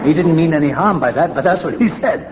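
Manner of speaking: talking through a laugh, pitch up. Real, not acted. Telling the AA meeting about his father, laughing. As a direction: let the laugh spill into the words.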